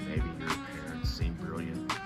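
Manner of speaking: ordinary delivery, nothing marked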